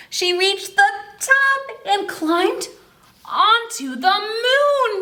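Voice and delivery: high, slow, drawn-out wonder; dramatic story reading. A narrator's reading. Reading the picture book to children, slow and high. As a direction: build it up to the moon.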